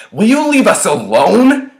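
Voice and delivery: high, loud furious demand; anger bursting out at full voice; angry yell. A narrator's reading. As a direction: yell at a harasser to back off. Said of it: "I yell".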